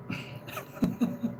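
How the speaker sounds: soft giggle between hiccups; breathy hushed giggle. Real, not acted. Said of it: "laughing at my hiccups".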